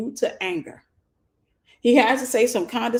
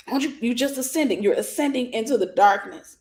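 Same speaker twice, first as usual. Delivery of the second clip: ordinary talk, nothing marked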